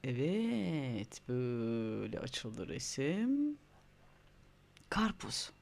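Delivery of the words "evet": ordinary talk, nothing marked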